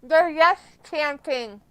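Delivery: loud, high excited call; thrilled loud commentary on the crowd. Real, not acted. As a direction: hype it up over the crowd.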